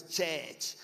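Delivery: "church" in a Ghanaian accent; Ghanaian pronunciation. Shown as a demonstration. Showing how Ghanaians name their worship place, Ghanaian accent. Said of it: "You go to Ghana, it's church".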